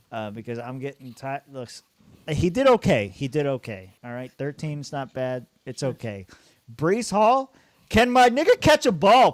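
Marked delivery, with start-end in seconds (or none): 2.3-3.1 he did okay, said loud with a sudden high jump; loud exclaim. Real, not acted. Reacting to a player's score. 6.7-9.3 loud and high, begging the player; frustrated yell. Real, not acted. Yelling at his player on screen.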